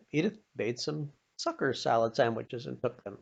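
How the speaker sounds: plain reading voice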